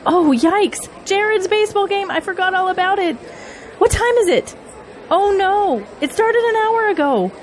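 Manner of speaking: oh no, I forgot my son; startled, guilty mother suddenly remembering; panicked realization. Acted. Realizes she missed son's game.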